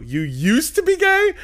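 slow, high, loud incredulous question; shocked disbelief. Retold or imitated. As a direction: gasp it out in disbelief.

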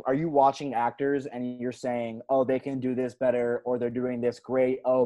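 ordinary talk, nothing marked

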